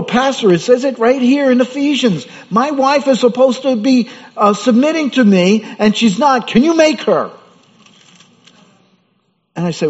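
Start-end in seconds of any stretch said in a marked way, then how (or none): 0.0-7.3 high, loud, whiny complaint to the pastor; a husband pleading to the pastor, high and insistent; complaining husband. Retold or imitated. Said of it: "They'll go".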